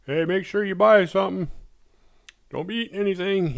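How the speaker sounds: high, loud put-on clerk voice; oblivious store clerk. Oblivious clerk scolding zombie shoppers, high put-on voice. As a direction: play the clueless shopkeeper nagging customers. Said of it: "the guy in there's like".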